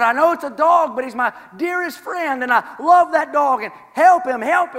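high, loud begging for his dog; desperate loud plea. Acted. Man begging the sailor to save his dog, loud. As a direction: beg loudly for the dog's life. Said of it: "He said".

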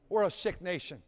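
ordinary talk

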